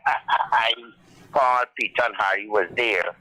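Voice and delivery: ordinary talk, nothing marked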